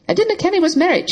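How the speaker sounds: high, loud furious outburst; angry cry. A narrator's reading. Woman defending herself to him, crying out angrily. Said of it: "she cried, stamping her foot".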